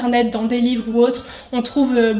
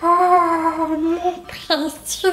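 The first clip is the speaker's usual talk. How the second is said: slow, higher creepy creature whine; drawn-out higher Gollum hiss; Gollum impression; 5 semitones higher, slower than usual. Shown as a demonstration. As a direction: crawl through it like Gollum craving his ring. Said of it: "je sais imiter, euh, Stitch et le Gollum".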